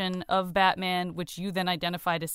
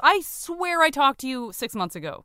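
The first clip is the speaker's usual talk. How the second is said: higher-pitched acted astonishment; lightly exaggerated incredulous protest; mock disbelief; 7 semitones higher, 4 dB louder than usual. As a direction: imitate a friend baffled at lost time. Acts out surprised out-of-touch friend.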